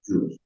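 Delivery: "juice" as one syllable, "joos"; pronunciación correcta. Shown as a demonstration. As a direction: say "juice" with a silent I. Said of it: "vendría a ser la pronunciación". ("juice" bien pronunciado, "yus", sin decir la "i")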